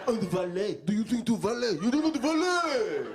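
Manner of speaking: outraged car listing grievances; indignant car. Acted. Car nags owner about valeting, high and outraged.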